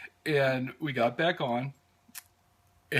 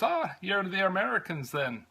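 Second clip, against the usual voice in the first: higher-pitched imitation of an Irish conductor's brogue; 6 semitones higher than usual. Conductor greeting American tourists on the train, Irish brogue. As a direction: do the friendly Irish conductor in an Irish accent. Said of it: "lovely brogue that I have".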